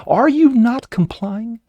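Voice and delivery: mock officer, escalating. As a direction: stretch it out like a bullying cop. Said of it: "he goes".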